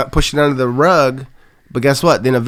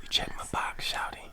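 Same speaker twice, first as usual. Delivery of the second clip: hushed playful whisper; 20 dB quieter than usual. Acted. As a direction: whisper it close and low. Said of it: "Why are you whispering?".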